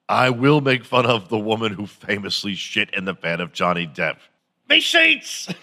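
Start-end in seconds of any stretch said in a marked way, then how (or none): 4.7-5.5 very high squealing wail; mock Amber Heard shriek. Amber Heard wailing about her sheets, very high squeal. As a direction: shriek it like you've just found the bed.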